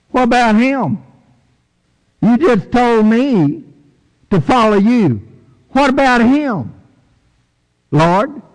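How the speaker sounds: high, whiny what-about-him; jealous disciple. Acted. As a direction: sulk and point at the other guy. Peter jealously asking Jesus.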